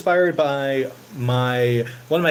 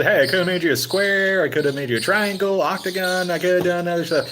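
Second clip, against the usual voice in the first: high, pleased genie justifying himself; a lightly voiced smug genie; 7 semitones higher than usual. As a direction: sell it like you did him a favour. Genie defends his choice.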